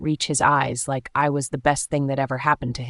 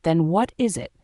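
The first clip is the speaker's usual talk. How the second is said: demanding, voice raised. A narrator's reading. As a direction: demand an answer. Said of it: "I raised my voice and demanded".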